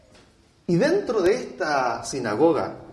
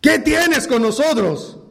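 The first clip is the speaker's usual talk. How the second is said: loud, high cry of a possessed man; demoniac's outcry; 5 semitones higher, 6 dB louder, slower than usual. Acted. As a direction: cry out in protest, drawing out the question. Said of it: "con la voz, de leer, con la entonación".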